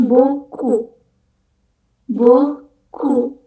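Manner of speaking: beaucoup dibaca boku; beaucoup, P akhir dihilangkan; silent final P. Shown as a demonstration. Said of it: "P terakhir itu nggak dibaca". (beaucoup tanpa P)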